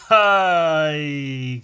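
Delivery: slow, loud, high; drawn out. Real, not acted. Telling viewers he has no topics, slow loud admission. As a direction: stretch it out, admitting defeat.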